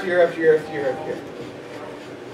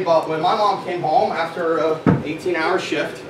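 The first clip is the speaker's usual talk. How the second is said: ordinary delivery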